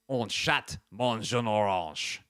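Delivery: slow learner French "Un chat mange un orange."; careful French. Shown as a demonstration. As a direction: read "Un chat mange un orange." slowly like an app sentence. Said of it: "You know what else I can say?".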